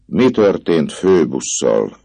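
put-on the girl presses him, cold and insistent; cold repeat. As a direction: ask again, cold as stone. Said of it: "ismételte hidegen a leány".

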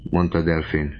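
plain reading voice

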